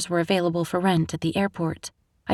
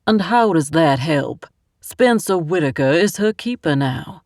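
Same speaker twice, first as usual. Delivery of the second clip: put-on louder man with a Southern accent, skeptical; 4 dB louder than usual. A narrator's reading. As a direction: push back, doubtful, Southern accent. The Southern man questions her.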